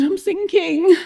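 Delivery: high-pitched groan of alarm. A narrator's reading. Jonah sinking in the water, groaning. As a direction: moan it high, going under. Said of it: "Jonah groaned".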